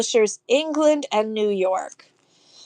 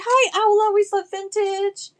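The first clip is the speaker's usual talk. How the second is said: much higher, louder hello; excited greeting; 10 semitones higher, 4 dB louder than usual. Real, not acted. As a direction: sing out the hello to a viewer. Greeting a live viewer.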